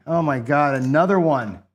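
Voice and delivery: can't believe the dice; real exasperated outburst. Real, not acted. Another losing roll.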